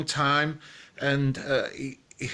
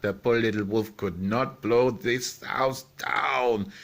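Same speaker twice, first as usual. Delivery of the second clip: ordinary delivery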